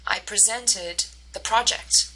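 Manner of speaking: "presented" stressed on SENT; weak first syllable, strong second in "presented". Shown as a demonstration. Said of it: "emphasis onto the second syllable".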